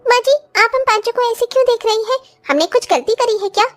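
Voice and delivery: put-on high voice of a young daughter-in-law offering help. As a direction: lift the voice into an eager young bride.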